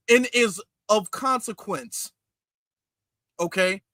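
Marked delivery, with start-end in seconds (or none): none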